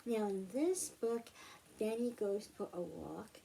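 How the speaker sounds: plain reading voice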